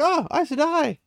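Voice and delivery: high-pitched acted exclamation; retold surprise. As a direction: exclaim it as startling news, voice leaping up. Acts out Min's reaction to news.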